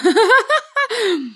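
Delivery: very high, sustained laughing fit; hysterical acted laughter. Else must keep on laughing, hysterical laughter. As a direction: burst into a high, uncontrollable laugh. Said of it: "Ich muss nur immer lachen".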